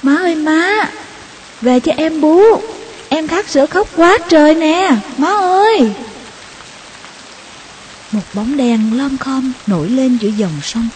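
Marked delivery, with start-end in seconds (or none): 0.0-5.9 high, loud pleading shout toward the river; desperate child shouting for mother; calling out desperately. Acted. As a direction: yell across the water for mom to come back. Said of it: "tôi gọi giật".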